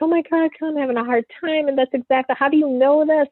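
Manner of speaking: high, half-sobbing quote of a friend; mock bawling friend. Retelling how the friend cried on the phone, high mock bawling. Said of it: "she just starts bawling".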